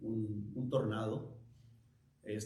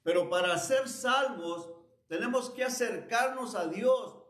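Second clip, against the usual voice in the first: higher-pitched, insistent sermon delivery; raised preacher's voice driving each phrase home; emphatic preaching; 6 semitones higher than usual. Real, not acted. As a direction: preach it with urgent conviction.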